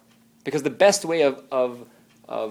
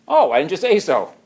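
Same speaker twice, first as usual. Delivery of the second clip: put-on a child suddenly convinced, louder; playful oh why didn't you say so; mock surprised child; 4 semitones higher, 6 dB louder than usual. Preacher voicing a convinced child, mock surprised.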